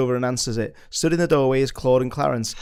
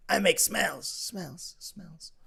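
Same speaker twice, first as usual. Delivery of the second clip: a voice repeating and dying away to nothing; fading echo; 4 semitones higher, 9 dB quieter, much slower than usual. Acted. The crystal whispers to young Cyril in a fading echo. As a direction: let the repeated word echo off into silence. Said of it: "whispers ... echoed through it".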